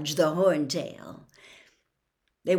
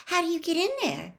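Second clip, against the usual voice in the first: innocently casual; 12 semitones higher, faster than usual. A narrator's reading. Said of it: "in an innocently casual sort of voice".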